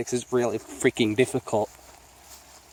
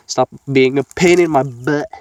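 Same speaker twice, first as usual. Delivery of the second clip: louder, exasperated scold; 9 dB louder than usual. Real, not acted. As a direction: tell the dog off, loud. Scolding the dog.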